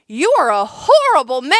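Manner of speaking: mock outraged yell at a stranger. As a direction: hurl it at him, outraged. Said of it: "I just yelled the words".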